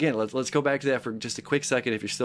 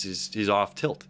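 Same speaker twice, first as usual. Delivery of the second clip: ordinary delivery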